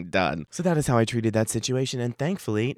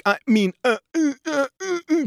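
higher and higher stammering excuses; stammering cheater; 4 dB louder than usual. Retold or imitated. Cheating partner caught out, stammering higher with each uh. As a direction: stall like a caught cheater with nothing to say. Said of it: "they're like".

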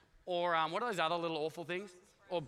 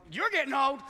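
loud, higher comeback; mock-offended retort; 7 semitones higher, 10 dB louder than usual. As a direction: throw it back at the doctor, loudly. Acted retort to the doctor.

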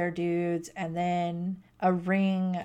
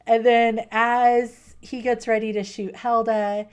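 louder, building excitement; excited loud telling; 5 semitones higher, 8 dB louder than usual. Real, not acted. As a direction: tell the climax with excitement. Excited climax retelling.

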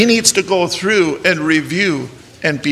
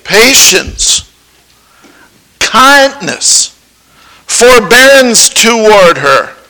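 slow, louder deliberate intoning; solemn vow reading; 3 semitones higher, 4 dB louder than usual. Acted. Preacher reading the covenant to the groom, slow. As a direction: weigh every word of the vow.

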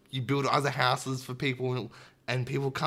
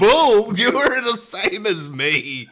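loud, higher, laughing objection; indignant protest; 9 semitones higher, 11 dB louder, slower than usual. Real, not acted. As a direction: shout it down, half laughing. Protests partner's accusation.

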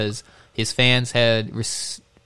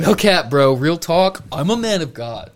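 trying too hard cool; 5 semitones higher, 6 dB louder than usual. Pretend. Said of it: "Did I get a little too hip on you?".

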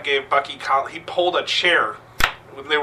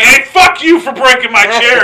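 loud, high mock rage; angry owner yell; 9 semitones higher, 13 dB louder than usual. Bar owner yelling at wrestlers over broken chair, loud. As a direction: bark it at them like a yelling boss. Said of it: "And D was like".

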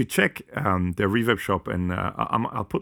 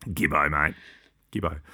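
mock Aussie bloke; 5 semitones lower than usual. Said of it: "Such an Aussie thing".

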